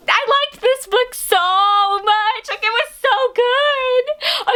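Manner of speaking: high, slow and gushing; gushing excitement. Real, not acted. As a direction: swoon over the book, drawn out up high. Gushing about a loved book.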